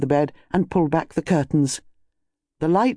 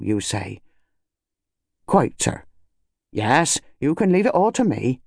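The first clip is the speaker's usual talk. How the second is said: mildly deferential constable on the phone; less impatiently official; 4 semitones higher than usual. Acted. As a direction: humour an important caller respectfully. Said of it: "It became less impatiently official".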